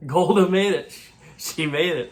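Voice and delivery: loud, high burst of joy; excited. Real, not acted. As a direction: cheer the surprise nomination. Cheering a correct prediction.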